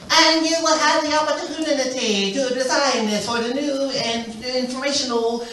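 Heidi Klum. Retold or imitated. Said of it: "she always likes to say".

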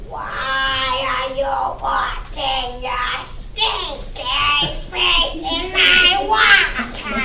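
very slow, drawn-out spooky sprite; water sprite voice. Acted. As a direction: stretch every word like a lake spirit.

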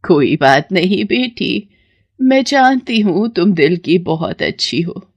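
usual speaking voice

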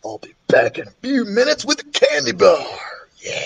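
breathy gloating stage whisper; excited whisper. Acted. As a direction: whisper it to a friend in triumph. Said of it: "Ryan whispered".

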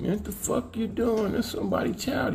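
high but soft mock outcry; appalled protest. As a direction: retell a shocked objection, high and hushed.

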